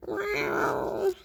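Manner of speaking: long, high thin mewl; imitated cat meow. A narrator's reading. Kitty having her face wiped, high mewling.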